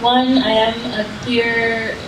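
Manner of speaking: normal talking voice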